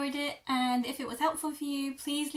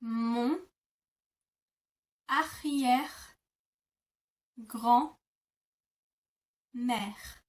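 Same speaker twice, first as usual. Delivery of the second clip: mon arrière-grand-mère stretched very slowly; very slow teaching demo; much slower than usual. Shown as a demonstration. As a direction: drag out every syllable. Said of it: "you would say".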